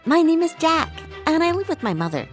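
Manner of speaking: plain reading voice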